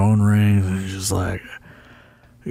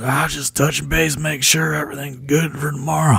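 put-on high, folksy old wrestler checking in; Tommy Rich impression; 6 semitones higher than usual. Tommy Rich checking on tomorrow's booking, high-pitched impression. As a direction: put the voice up and play the old-timer touching base. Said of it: "my Tommy Rich impression was pretty good".

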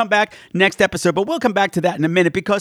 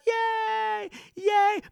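excited cheer; 14 semitones higher, 7 dB quieter than usual. Real, not acted. Cheering his own movie pick.

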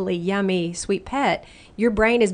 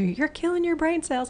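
mock parent scolding; 9 semitones higher than usual. Parents scolding teen, voiced quote.

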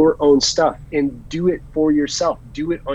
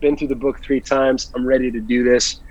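usual speaking voice

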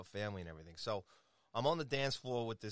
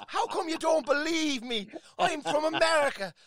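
high, loud, drawn-out Irish wail; Irish accent; 11 dB louder than usual. Retold or imitated. Passenger pleading with Dublin cab driver, shouted Irish accent. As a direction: plead at full volume in a hammed-up Irish brogue. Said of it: "I can put the Irish accent on".

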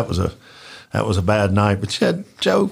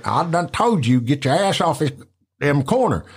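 higher put-on tough voice; imitating Joe running off crackheads; mock Joe scolding; 4 semitones higher than usual. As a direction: order them off the corner.

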